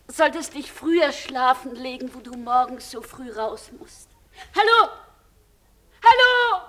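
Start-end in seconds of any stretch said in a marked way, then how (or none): 4.5-6.6 loud, high call down a bad line; calling into the phone. Woman calling her lost lover on the broken line, loud. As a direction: call out to find him again.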